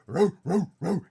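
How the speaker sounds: loud growling wolf noise; loud beastly growl. Acted.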